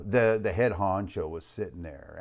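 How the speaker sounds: ordinary talk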